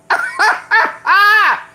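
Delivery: sudden high cackling laugh; high burst of laughter. Real, not acted. Laughing at her own smart mouth, a loud high laugh.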